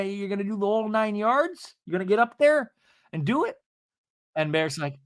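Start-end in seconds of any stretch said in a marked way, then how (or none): 0.0-3.6 high, put-on eager questioning; high-pitched mock husband; Seth imitation. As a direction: keep pressing in a high goofy voice. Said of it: "my Seth imitation".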